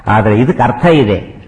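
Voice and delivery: ordinary talk, nothing marked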